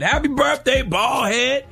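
high-pitched teasing birthday shout-out; mock birthday greeting. Mocking how people get reduced to one trait, high teasing greeting. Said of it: "We will reduce people to whatever".

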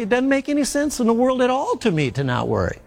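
high-pitched, baffled protest; mock incredulity. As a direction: protest it as a doubter who can't believe it. Acts out a baffled doubter.

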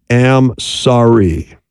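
"am sorry" said very slowly, each word weighted; slow, stressed am sorry. Shown as a demonstration. Counselor spelling out the key words, slow. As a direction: lean on "am sorry", drawn out. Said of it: "followed by".

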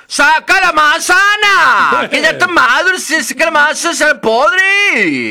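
a tree urgently calling for its fruit to be picked; apple tree's cry. Acted. Apple tree urges the girl to pick ripe apples, high and loud. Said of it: "y el manzano le decía".

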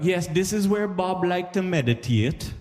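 high, slow tour-guide voice; Jamaican tour guide. Acted. Guide showing [name]'s house, put-on tour patter. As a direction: present it slowly, pitched well up. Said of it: "So they'll be like".

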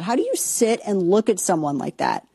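ordinary talk, nothing marked

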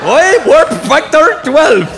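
loud, high, drawn-out mock Scottish yelp; Scotty. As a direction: garble it in an exaggerated Scottish accent, slowly. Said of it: "Scotty in Star Trek was the only one we couldn't understand".

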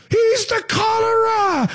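high, drawn-out accusing cry; movie mob accuser. Retold or imitated. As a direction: cry it out like a panicked villager. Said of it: "that horrible Robert De Niro Frankenstein movie".

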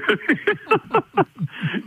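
a real, high burst of laughter. Real, not acted. Host laughs at own barfly joke.

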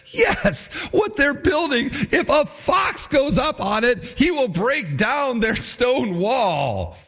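high-pitched hammed-up heckle; exaggerated jeering. Tobiah jeering beside Sanballat at the wall, mocking. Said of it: "Emphasis added for effect".

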